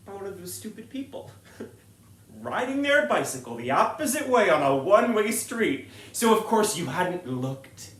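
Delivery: loud, high, frantic rant; a comic rant about reckless cyclists. Real, not acted. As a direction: build to an outraged rant.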